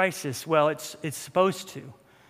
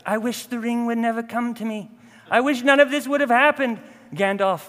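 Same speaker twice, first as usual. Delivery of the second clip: put-on higher-pitched Frodo in a shaky-quality British accent; poor British accent; 4 semitones higher, 5 dB louder than usual. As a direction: plead in an amateur British accent. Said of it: "my British accent is poor".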